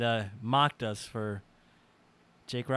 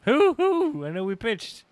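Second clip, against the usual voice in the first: high laughing hoots; 10 semitones higher, 7 dB louder, slower than usual. Real, not acted. Mock laughing echo.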